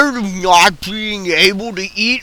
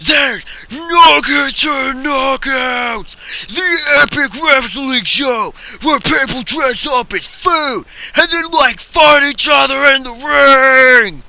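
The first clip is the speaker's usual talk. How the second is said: wrestling promo shout; 5 semitones higher than usual. Acted.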